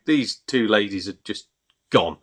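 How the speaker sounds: usual speaking voice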